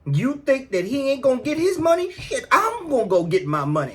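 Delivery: ordinary delivery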